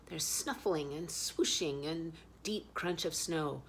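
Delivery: ordinary delivery, nothing marked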